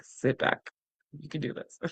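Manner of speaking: hushed coaxing self-talk; self-talk whisper. Retold or imitated. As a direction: whisper encouragement to yourself. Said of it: "It's like".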